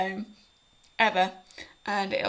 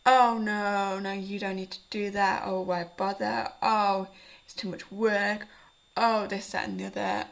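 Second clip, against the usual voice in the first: slow, drawn-out mock complaining; lazy inner voice; 4 dB louder, slower than usual. Vlogger imitating the morning voice of laziness, reluctant and whiny. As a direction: imitate the voice that talks you out of everything. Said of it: "everybody wakes up in the morning with this voice".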